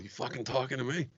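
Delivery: a hint of a loner daring someone in the mirror; Travis Bickle. Retelling a clash with a coworker, a light Travis Bickle mirror taunt. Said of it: "I turned into Travis Bickle".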